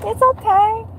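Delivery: high, sing-song it's okay; squeaky self-soothing; high reassuring. Real, not acted. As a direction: brush it off brightly. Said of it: "I'm laughing and not paying attention".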